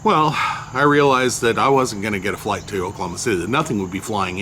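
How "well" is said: ordinary delivery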